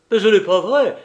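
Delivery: high, loud furious denial; angry outcry. A narrator's reading. Banker shaking his fist at Lupin, crying out angrily. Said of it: "s'écria Engelmann en lui montrant le poing".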